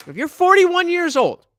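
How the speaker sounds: loud, high cry of disbelief; exasperated outburst. Real, not acted. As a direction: raise pitch and volume, incredulous. Scornful outburst about interviewee's age.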